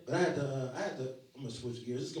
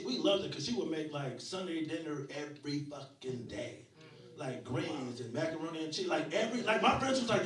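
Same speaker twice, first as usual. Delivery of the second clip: normal talking voice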